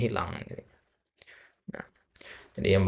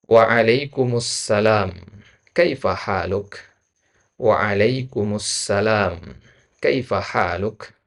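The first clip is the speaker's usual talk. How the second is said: slow model reading; 5 dB louder, much slower than usual. Shown as a demonstration.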